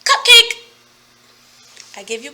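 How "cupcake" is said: high, loud "Cupcake" with delight; excited teacher reveal. Shown as a demonstration. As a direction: announce the word like a treat. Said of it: "Next word is".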